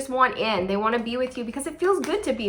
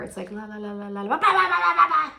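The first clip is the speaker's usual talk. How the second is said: mock babble; 4 semitones higher, faster than usual. Imitating erratic babble.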